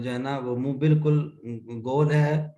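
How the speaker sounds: ordinary talk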